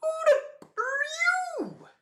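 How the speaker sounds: hammed-up outraged yell at a stranger; mock furious Kid yell. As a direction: scream it like an enraged pirate captain. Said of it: "Kid just straight out like".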